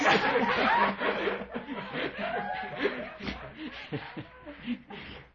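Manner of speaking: long high laugh bursting out; real laughter. Real, not acted. Teacher cracking up at the riddle's last line, real laughter. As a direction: burst out laughing and keep it going.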